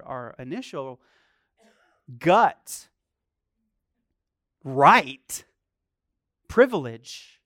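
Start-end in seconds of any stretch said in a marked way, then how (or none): none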